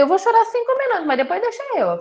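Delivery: jumps way up, fast and bright with resolve; high excited quote. Retold or imitated. She tells the doctor's room she'll cry only five minutes, in a high quick burst. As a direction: fling the line out high and quick.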